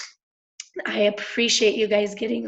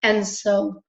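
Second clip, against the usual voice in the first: normal talking voice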